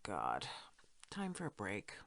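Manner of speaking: soft, whispered sigh of giving up; weary whisper. Acted. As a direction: let it all go, worn out. Exhausted, gives up.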